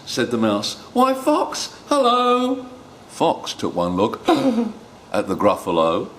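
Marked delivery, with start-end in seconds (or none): 0.9-2.6 high-pitched, sing-out mouse hello; high little mouse voice calling out; cheery mouse greeting. Acted. 4.3-4.7 sharp high gasp; acted gasp of alarm; frightened gasp. Fox sees the Gruffalo.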